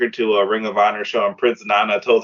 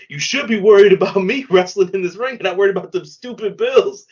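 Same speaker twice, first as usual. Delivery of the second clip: mock heckle; 6 semitones higher, 4 dB louder than usual. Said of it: "And he was like".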